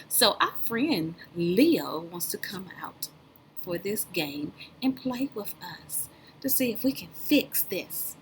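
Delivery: hushed unvoiced telling; conspiratorial whisper to the kids. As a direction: whisper it like a secret to the class. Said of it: "unvoiced whisper".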